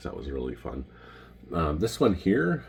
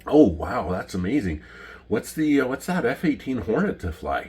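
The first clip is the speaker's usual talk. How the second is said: higher-pitched impressed reaction; imitated amazement; 7 semitones higher, 4 dB louder than usual. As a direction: play the impressed pilots, pitched up. Retold impressed pilots' reaction.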